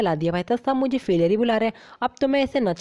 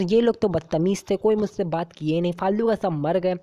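ordinary talk

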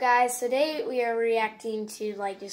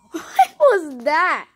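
surprised; 6 semitones higher, 13 dB louder, much slower than usual. Real, not acted. Loud slow shocked reaction.

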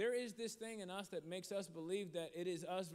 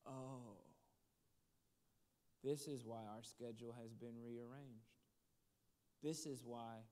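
low, soft, measured dawning awareness; hushed realization; 7 semitones lower, 6 dB quieter than usual. Retold or imitated. Husband realizing why they got this waitress, hushed. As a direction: let it sink in quietly, with long pauses.

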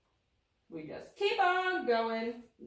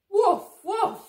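loud playful puppy woofs; puppy barks; 9 semitones higher, 13 dB louder than usual. Acted. Being Biscuit the puppy for the kids, loud playful woofs. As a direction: bark like a happy little dog.

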